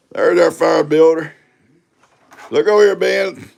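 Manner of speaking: loud and high teasing shout; teasing Ben loudly across the yard; calling out. Real, not acted. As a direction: holler across at Ben, playful.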